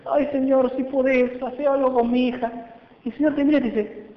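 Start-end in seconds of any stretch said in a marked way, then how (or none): 0.0-2.5 higher, lightly whining plea; whiny prayer. Acted. Preacher mocking how people pray, lightly whining. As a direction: mimic a timid begging prayer. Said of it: "oramos como este hombre".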